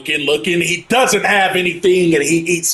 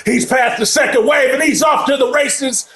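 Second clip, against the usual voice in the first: excited shout; 10 semitones higher than usual. Real, not acted. Commentator calling the kick return breakaway, high excited shout. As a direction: yell it as the returner breaks free.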